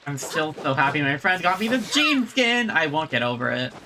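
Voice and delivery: ordinary talk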